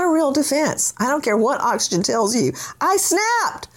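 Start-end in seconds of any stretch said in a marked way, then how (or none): 2.8-3.7 mock sobbing plea. Mocking the "snapped" defense.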